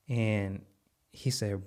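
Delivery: ordinary delivery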